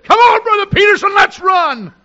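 high, loud revival shout; excited shout. Retold or imitated. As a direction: call him out to run, full force. Retold preacher urging pastor to run.

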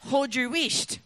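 broad Scots hush, hissing the wheesht; Scottish accent. Shown as a demonstration. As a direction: say it like a Scottish granny. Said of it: "another saying that they have is".

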